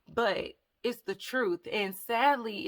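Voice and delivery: usual speaking voice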